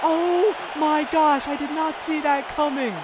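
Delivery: slow, high, repeated gasps of disbelief; stunned disbelief. Real, not acted. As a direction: drag each one out in shock. Stunned by the fast knockout.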